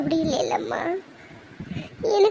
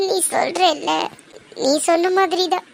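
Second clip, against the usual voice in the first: ordinary talk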